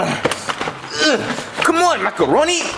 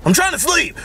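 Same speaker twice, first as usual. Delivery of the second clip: ordinary delivery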